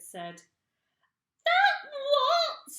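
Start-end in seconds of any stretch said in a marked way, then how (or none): 1.4-2.7 very high loud shriek; screamed. Acted. Missus Twit screaming at her husband. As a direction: shriek the question. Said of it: "screamed Missus Twit".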